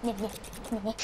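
low, soft creature noise; imitating Mister Snappy. Player voicing Mister Snappy, low mocking noise. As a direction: mimic a snappy creature under your breath. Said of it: "He's like".

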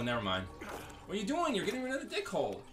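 ordinary talk

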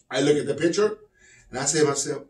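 ordinary delivery

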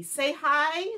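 normal talking voice

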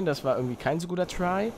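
ordinary talk

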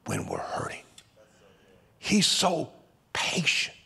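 breathy preacher's hush; awed whisper. Real, not acted. Preacher marvelling at God's patience, whispering. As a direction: let it fall to a whisper of wonder.

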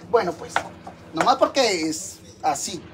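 usual speaking voice

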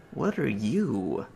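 slow, soft, awed question; quiet slow wonder; hushed awe. Real, not acted. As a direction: ask it quietly, half afraid. Awed by strange alien device.

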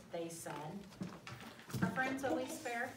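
plain reading voice

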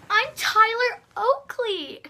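high, slow, gushing mock voice; Tyler Oakley impression. Brother ordered to imitate a YouTuber, high hammed-up gushing. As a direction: camp it up as a bubbly YouTuber. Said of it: "I sound like someone else".

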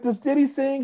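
loud, high-pitched retold disbelief; incredulous. Vocal coach asked to coach [name], incredulous. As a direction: act out a baffled question, voice shooting up.

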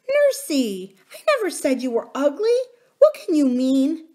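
high, bright and gentle forgiveness; a child forgiving her nurse; little princess. A narrator's reading. Princess protests.

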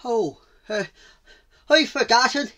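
loud, high, hesitant confession; flustered cheetah. Acted. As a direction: stumble into admitting it. Cheetah admits forgetting.

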